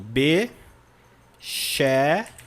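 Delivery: "Beschäftigt" very slow, with long gaps between syllables; "Beschäftigt" pulled apart piece by piece. Shown as a demonstration. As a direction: break "Beschäftigt" into be, schäf, tigt. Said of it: "vou até colocar separado por, por ponto".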